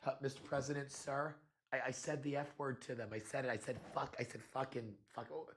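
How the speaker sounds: put-on like a boy confessing into the phone; mock Vance whispering to the president that he swore; mock hushed confession.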